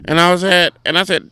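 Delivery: normal talking voice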